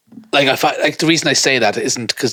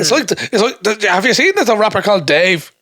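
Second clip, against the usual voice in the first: high-pitched comic bit voice; comic character voice; 8 semitones higher than usual. Acted. Co-host putting on a bit about a rapper, high comic voice. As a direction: launch into a silly, high-pitched voice.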